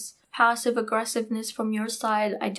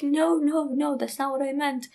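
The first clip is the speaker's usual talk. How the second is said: higher-pitched, insistent denial; retold protest; 5 semitones higher than usual. Speaker correcting an upset friend, protesting in a raised pitch. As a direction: act out backing away from a misunderstanding.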